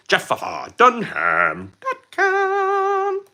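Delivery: high, slow squeaky puppet voice; Peanut puppet voice. Retold or imitated. Streamer quotes a ventriloquist puppet for chat, squeaky puppet voice. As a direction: do the wacky puppet's catchphrase. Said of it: "I could just quote Peanut like that".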